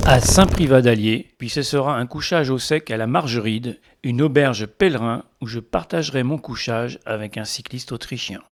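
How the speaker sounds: voiceover narration. A narrator's reading. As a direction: narrate the route like a documentary.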